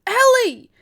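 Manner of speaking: very loud, high-pitched yell; yelling her name. A narrator's reading. Ray stopping Ellie from going further, high yell. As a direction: yell to stop her at once. Louder repeat to stop Ellie.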